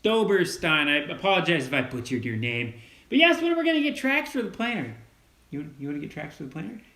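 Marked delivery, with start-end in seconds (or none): 3.1-4.9 quick, higher voice for the viewer's question; reading out a question. Retold or imitated. Son reads a viewer's question to his dad, lightly put-on voice. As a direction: read the comment in a lightly put-on voice.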